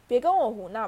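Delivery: loud, impatient warning; sharp burst of irritation; angry scolding. A narrator's reading. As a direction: shut down the nonsense forcefully. Caretaker scolds the teasing poltergeist.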